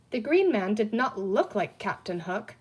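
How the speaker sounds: usual speaking voice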